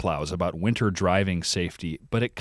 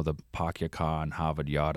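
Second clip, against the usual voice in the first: a hint of Boston, dropping the R's; half-hearted Boston accent, R's dropped; self-admittedly weak Boston accent; 4 semitones lower, 6 dB quieter than usual. Shown as a demonstration. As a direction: try a dropped-R Boston line and fall short. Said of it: "I can't do it very well".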